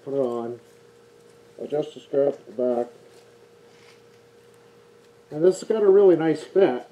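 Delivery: muffled behind face mask; through a respirator mask. Real, not acted. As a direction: mouth covered by respirator. Seen 5: Respirator covers nose and mouth throughout; absent in references.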